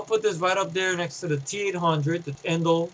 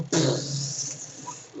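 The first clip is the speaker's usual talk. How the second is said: flamethrower noise; 4 semitones lower, 7 dB quieter than usual. Retold or imitated. Flamethrower firing in the scene.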